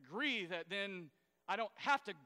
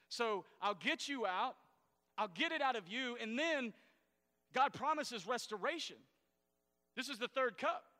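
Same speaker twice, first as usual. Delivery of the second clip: higher, louder preaching build; preaching at full force; 4 semitones higher, 4 dB louder than usual. Acted. Pastor stirs the congregation about God's promises, preaching hard. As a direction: drive each promise home to the crowd.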